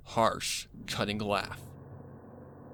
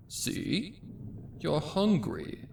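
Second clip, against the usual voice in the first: high, slow, sickly-sweet villain voice; creepy coaxing; 9 semitones higher, slower than usual. Acted. The cult urges the starving filly to eat, creepy and slow. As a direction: coax her like a predator.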